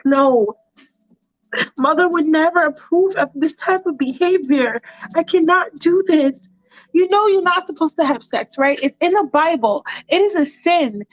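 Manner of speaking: put-on high-pitched, scandalized young man; prim Michael. Michael citing mother and Bible, high scandalized refusal. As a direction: protest primly in a high voice. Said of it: "And he's like".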